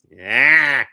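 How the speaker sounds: loud, high cat cry; sharp mock meow; imitating a cat's yowl. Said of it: "I just hear".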